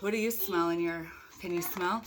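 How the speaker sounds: ordinary delivery, nothing marked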